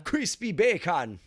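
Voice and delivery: Jamaican accent. Shown as a demonstration. Gamer shows his Jamaican accent trick, slow and loud. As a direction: lean into Jamaican vowels on crispy bacon. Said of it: "when I'm doing Jamaican".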